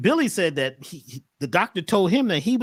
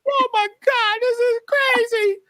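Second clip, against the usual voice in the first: very high, louder, slow awed cry; amazed cry; 4 dB louder than usual. Retold or imitated. Retelling crying at the couple's beach wedding, very high. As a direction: gush as if in tears of joy. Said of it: "I said".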